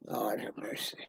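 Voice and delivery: breathy whisper, almost no voice. Real, not acted. Whispering to herself while reading the chat. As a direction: let it slip out under your breath.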